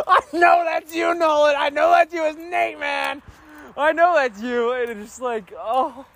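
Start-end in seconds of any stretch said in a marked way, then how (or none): 0.1-4.7 excited fan shout. Retold or imitated. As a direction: call out the actor with delight.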